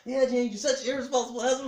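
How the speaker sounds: high, loud, fast mock nagging; scolding wife. Wife scolding husband who quit walking, exasperated. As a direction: imitate a wife rattling off her reproach.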